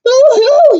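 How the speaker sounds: high, loud mock wail; hooting in alarm; frightened boo-hoo. Owl flees in fright.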